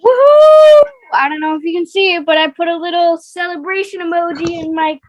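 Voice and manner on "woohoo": high, loud cheer. Real, not acted. Cheering the kid's drop.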